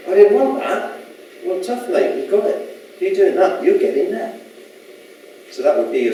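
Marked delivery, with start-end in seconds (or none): 0.1-1.0 whiny complainer. Acted. Voices complaining person in dialogue. 1.4-4.4 higher, offhand bloke answering back; cheeky reply. Acted. Voices the reply in dialogue.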